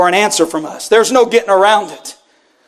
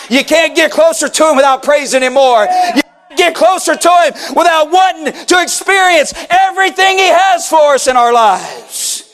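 fervent preacher, raised and driving; preaching at full force; 5 semitones higher than usual. Acted. Pastor pressing the church with a fervent call. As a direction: press the question on the congregation.

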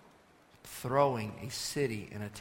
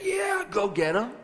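high, drawn-out mock cheer; gung-ho rooting, exaggerated and slow; mock excitement; 10 semitones higher, 3 dB louder than usual. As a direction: cheer it on with put-on glee. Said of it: "We don't say that with, um, excitement".

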